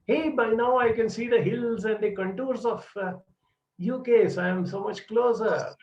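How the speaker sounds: high, bright hopeful calling; hopeful swimmer. Retold or imitated. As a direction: lift the voice as the coast appears. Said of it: "And she would look up and say".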